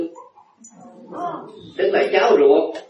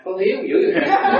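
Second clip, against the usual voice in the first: sarcastic remark; 6 semitones higher than usual. Retold or imitated. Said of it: "tôi nói".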